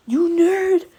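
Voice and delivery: high, loud mock scream; sheer hatred. Rival insulting his opponent, hateful imitated scream. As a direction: hurl the insult at full tilt. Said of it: "out of sheer hatred for him".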